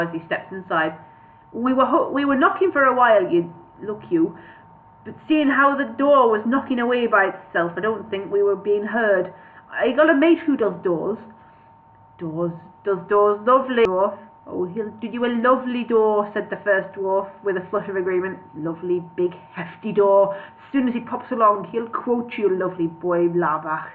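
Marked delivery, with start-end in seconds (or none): 1.5-16.3 dwarf with a shaky attempt at Welsh; bad Welsh accent. First dwarf excusing the broken door, bad Welsh accent. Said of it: "I apologise for the Welsh people". 18.5-23.9 hammed-up Welsh dwarf; chatty dwarf in badly faked Welsh; bad Welsh accent. First dwarf recommending his door-fixer, bad Welsh accent. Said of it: "I can't do a Welsh accent".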